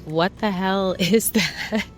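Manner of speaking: slow, laughing mock horror; mock disgusted guest. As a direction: play a baffled guest, amused. Imagined guest reacting to plant.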